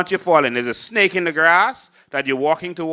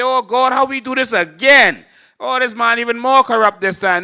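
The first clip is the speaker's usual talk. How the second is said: higher, exasperated mock lament; hammed-up despair, pitched up; mock fed-up voters; 8 semitones higher, 5 dB louder than usual. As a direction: play the crowd groaning at its leader. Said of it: "Then you say".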